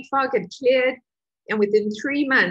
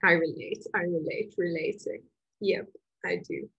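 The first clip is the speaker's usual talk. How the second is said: quiet admission; 3 semitones lower, 8 dB quieter than usual. Real, not acted. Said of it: "quiet personal admission".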